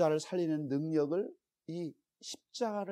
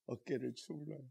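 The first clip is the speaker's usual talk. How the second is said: normal talking voice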